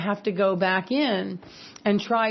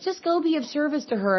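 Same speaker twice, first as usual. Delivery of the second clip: quoting a friend, much higher; pitched-up imitation of a friend; high mock friend voice; 7 semitones higher than usual. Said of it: "I've had friends that are like".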